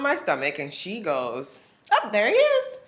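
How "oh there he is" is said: high, light sing-song; sweet technician voice. Retold or imitated. Technician finds the baby on the ultrasound, high and cooing. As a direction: coo at the screen.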